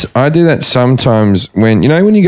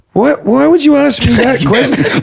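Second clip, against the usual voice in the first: higher, baffled "what?" thrown back at the host; feigned confusion; 10 semitones higher than usual. Retold or imitated. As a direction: play dumb, outraged at the question. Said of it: "I stand there all confused".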